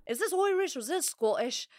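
wobbly Irish accent sliding into Scottish. Shown as a demonstration. Host questioning her own bad accent, muddled Irish-Scottish lilt. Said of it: "sounds a bit Scottish".

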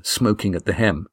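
plain reading voice

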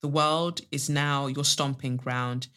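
measured poetry reading with long pauses; poem recital. Acted. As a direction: let each line fall softly and settle. Reads listener's poem aloud.

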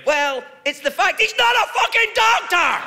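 high, loud outraged shout; indignant shout. Pretend. Comic answers imagined heckler, high outraged shout. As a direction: yell it in mock outrage.

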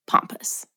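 "pampas" as POM-pus, softly; ah-vowel pampas. Shown as a demonstration. Co-host showing the pronunciation, quiet "pampas" like pompous. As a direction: say "pampas" with a soft, open ah. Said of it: "pretty sure it's pronounced".

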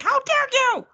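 high, loud mocking how dare you; mock outraged yell. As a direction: shout it like the offended husband. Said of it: "is like".

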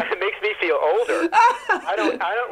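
high, rueful laugh through the words; half-crying laugh. Real, not acted. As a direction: laugh it off as you admit it. Laughs about feeling older.

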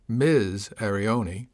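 "Miz" drawn out slowly and stressed; pointed, slow emphasis on "Miz" before the name; special emphasis on Miz. A narrator's reading. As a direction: lean hard and long on the word "Miz". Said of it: "special emphasis on the word Miz".

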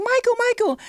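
very high, loud imitated calling; hammed-up high fan cry; excited fan. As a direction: mimic a fan squealing for a star. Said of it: "a fan, like, at the window".

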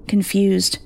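ordinary delivery, nothing marked

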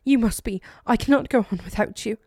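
cling to him with panic; frantic pleading. A narrator's reading. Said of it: "said quickly, sounding irrational".

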